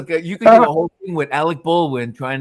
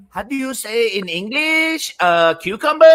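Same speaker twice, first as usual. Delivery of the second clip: mock Spanish accent; 8 semitones higher, 3 dB louder than usual. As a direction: stretch out a badly faked Spanish accent. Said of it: "So she faked this accent".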